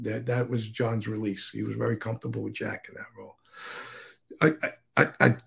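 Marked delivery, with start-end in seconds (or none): 4.4-5.3 real stumbling restart on I; stuttering. Real, not acted. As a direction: caught stuttering, restarting the word. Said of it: "I'm stuttering right now".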